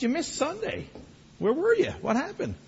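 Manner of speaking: high, soft puzzled questions; light, high-voiced retold query; retold gentle questioning. Retells questioning the absent member.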